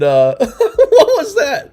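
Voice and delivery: high, slow, laughing question. Real, not acted. Host baffled by caller's distorted audio, laughing. Laughing at a garbled phone call.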